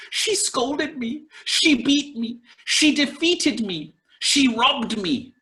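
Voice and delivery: pitched high, indignant list of wrongs; aggrieved complaint. Retold or imitated. Teacher voices someone listing grievances, high and indignant. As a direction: whine each grievance like it still stings. Grudge quote with she.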